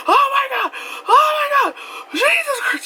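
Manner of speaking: high panicked shrieks; losing it. Retold or imitated. Navigator in the car losing it over the deer. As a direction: scream it out in full panic. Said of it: "Just losing it".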